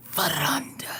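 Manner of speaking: hushed, voiceless whisper. Pretend. Streamer spotting a viewer, dramatic whisper.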